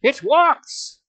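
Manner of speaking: high, loud cry of alarm; sudden high yell; startled shout. Acted. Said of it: "he shouted".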